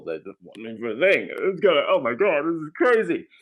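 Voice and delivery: worked up, pitch climbing as it goes; gushing, overwhelmed mock freak-out; retold freak-out. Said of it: "I'm, like, freaking out".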